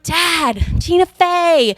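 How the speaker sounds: mock exasperated daughter. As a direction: insist as if he should know this. Exasperated daughter repeats name to dad.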